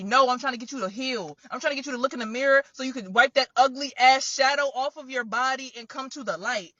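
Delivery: ordinary delivery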